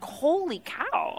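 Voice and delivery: hushed, drawn-out amazement; stunned disbelief. Retold or imitated. Guest hears about life in Congo, hushed slow astonishment. Stunned by what she heard.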